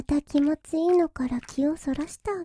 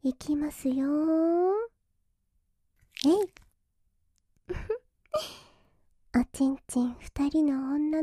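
whispering. Acted. Whispered start.

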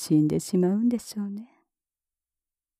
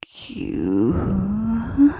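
drawn-out squeeze sound; 5 dB louder, much slower than usual. Acted. Pinching nipple, drawn-out sound effect.

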